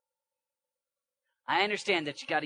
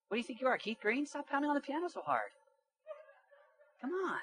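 high, incredulous friend voice; mock chiding; 10 semitones higher, 3 dB quieter than usual. Imitates friend chiding a zealous musician.

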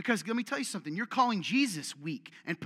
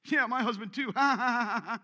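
high, loud put-on cackle; mock laughing; 5 semitones higher, 7 dB louder than usual. Preacher mocking wives laughing at husbands online. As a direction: ham up women's laughing comments. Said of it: "comments of".

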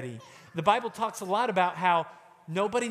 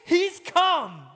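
much higher, louder cry of joy; joyful awe; 12 semitones higher, 7 dB louder, much slower than usual. Acted. Believers meeting Jesus, crying out in joyful awe. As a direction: exclaim in overwhelmed wonder, slowly. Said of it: "they will go".

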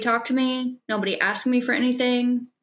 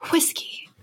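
whispered; 6 semitones higher, 4 dB quieter, slower than usual. Acted. Hushed stand-in for a cuss.

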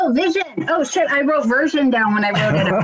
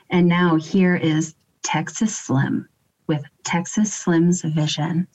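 sexy intro read; 3 semitones lower than usual. Pretend. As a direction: ease into the welcome, drawing out the sign-off. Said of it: "get a little bit more sexy with it".

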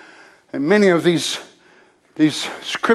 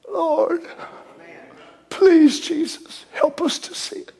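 softer, breaking prayer trailing to a whisper; hushed prayer; 10 semitones higher, 3 dB quieter, slower than usual. Acted. Preacher praying over the congregation, hushed. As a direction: plead gently with God. Prays softly.

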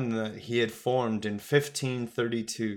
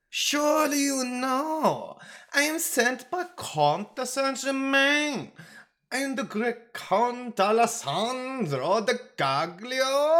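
put-on very high, grandiose Italian accent; boastful Italian aristocrat; 4 dB louder than usual. A narrator's reading. Traveler announces his grand title, high boastful Italian accent. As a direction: puff yourself up and boast in theatrical Italian. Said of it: "the traveler boasted with an aristocratically puffed bosom".

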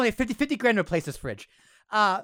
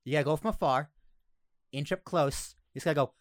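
ordinary delivery

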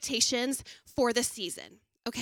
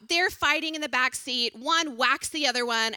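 ordinary delivery

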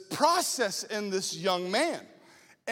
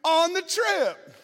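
put-on higher, louder, slow hopeful teen; eager kid; 6 semitones higher, 4 dB louder, slower than usual. Boy asking about a girlfriend, high and eager. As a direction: blurt the real question. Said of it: "He was like".

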